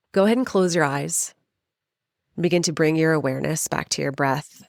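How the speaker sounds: spacious, even meditation voice with long pauses; guided meditation. Acted. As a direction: guide the listener gently inward, phrase by phrase.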